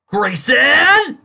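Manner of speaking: very loud, high shout of the name; enraged boss yelling; bellow. Acted. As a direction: bellow it in fury.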